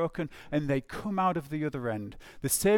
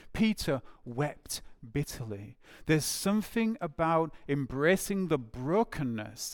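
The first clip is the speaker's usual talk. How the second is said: usual speaking voice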